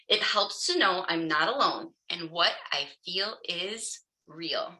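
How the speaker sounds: ordinary talk, nothing marked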